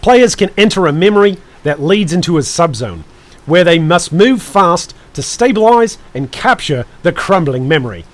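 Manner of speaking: put-on New Zealand announcer accent; amateur New Zealand accent, punchy phrasing. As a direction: read it out in a mock Kiwi accent. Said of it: "I need my Australian accent ... New Zealand".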